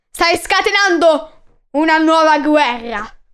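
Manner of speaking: loud, slow, heavy shout; shouted warning. Acted. Messenger shouts at the king, slow and loud.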